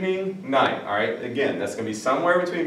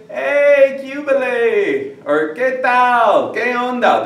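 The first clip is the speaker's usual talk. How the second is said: loud high casual "Hey" and "¿Qué tal?"; informal greeting; 11 semitones higher, 6 dB louder, slower than usual. Shown as a demonstration. Teacher acting out greeting a friend, casual and loud. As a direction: greet a friend loosely and loudly. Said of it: "Those are all informal greetings".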